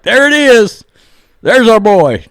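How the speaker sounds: high, loud hyped-up cheer; fired-up fan. Acted. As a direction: cheer your hero's entrance, loud and high. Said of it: "You're really fired up".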